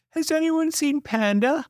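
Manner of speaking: high, urgent call to the house; anxious high call. A narrator's reading. Sister searching the house.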